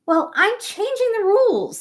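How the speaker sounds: high, loud frog voice; defiant frog. Acted. Frog tells the cat and dog the new rules, defiant. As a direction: put your foot down. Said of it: "said the frog".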